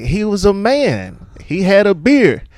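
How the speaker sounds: high, loud hammed-up grandfather; high mock old man. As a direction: squeak it out like a cranky grandpa. Grandfather voiced in movie retelling.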